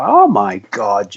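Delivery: slow, low, drawn-out mock dismay; mock shocked gasp. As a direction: pretend to be floored by the news. Retelling reaction to kids' ages.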